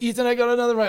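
high, loud eager call across the range. Retold or imitated. Guy calling to Ethan on the range, high and loud. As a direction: yell it up high to get Ethan's attention. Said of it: "He's like".

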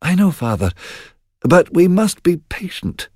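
a son calming his father; heavy-hearted son. Acted. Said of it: "replied Donalbain with a heavy heart".

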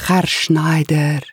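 put-on slow Spanish-accented address; Spanish-accented butler. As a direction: call him slowly, Spanish accent. Eustacio's accented voice.

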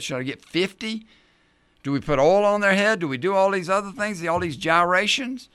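a nervous man begging for a checklist; anxious flustered believer. Pretend. Pastor voices a flustered believer panicking, anxious and fast. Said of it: "we'd say".

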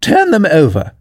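high, irate royal command; angry order. A narrator's reading. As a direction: order the servant crossly. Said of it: "turned angrily away from him".

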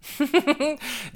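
high burst of laughter. Real, not acted. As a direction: laugh out loud. Laughing at own joke.

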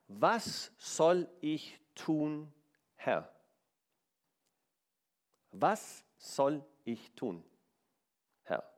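very slow, soft, pleading question; a drawn-out, hushed prayer to the Lord; humble plea. Acted. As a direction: ask it slowly, bowed in humility.

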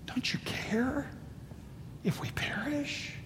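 desperate hushed whisper; frightened disciples whispering in the storm; whispered plea. Acted. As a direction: breathe the question out in fear.

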